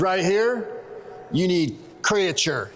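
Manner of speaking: slow, lightly put-on salesman accent; mock shop-guy accent, drawing out the product name; Chet accent. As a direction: caricature a store clerk's accent, slowly. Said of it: "have to talk in this accent when I do Chet".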